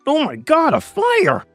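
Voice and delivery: high-pitched cry of alarm. Acted. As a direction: cry out as you spot danger. Onlooker discovers fire.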